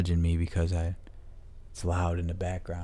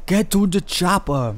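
action-movie quote; 12 semitones higher, 9 dB louder, slower than usual. Pretend. Movie quote as helicopter passes.

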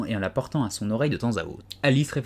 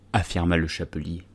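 plain reading voice

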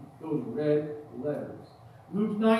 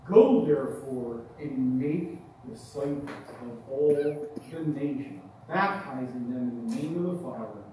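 scripture reading; 5 semitones lower than usual. Acted. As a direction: let each phrase land with reverence. Reads scripture aloud.

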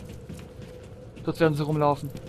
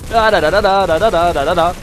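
mock gunfire; 5 dB louder than usual. Mimicking gunfire while shooting.

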